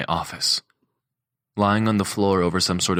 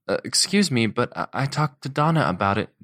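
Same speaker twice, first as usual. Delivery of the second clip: higher, hesitant woman's voice; timid Alice; 6 semitones higher than usual. A narrator's reading. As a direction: stumble nervously through it. Chef explains timidly.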